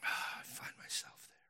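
sighed, whispered confession; rueful whisper. Real, not acted. Preacher admitting he does the same, rueful whisper.

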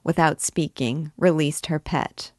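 usual speaking voice

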